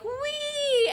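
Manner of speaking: high squeal of delight; gleeful whoop. Retold or imitated. Climber throwing herself back off the cliff, high gleeful squeal. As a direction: fling it out like a thrill ride. Girl swinging off rock face.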